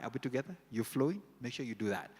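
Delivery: ordinary talk, nothing marked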